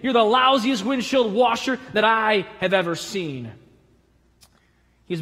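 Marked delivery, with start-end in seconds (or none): 0.0-3.5 retold scream. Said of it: "Apparently, he screamed".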